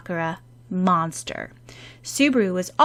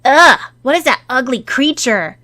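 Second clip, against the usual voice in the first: put-on loud, higher disgusted kid voice; disgusted demand; 6 semitones higher, 9 dB louder than usual. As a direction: sneer at the ugly puppy. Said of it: "Subaru demanded".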